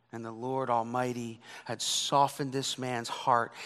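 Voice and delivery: normal talking voice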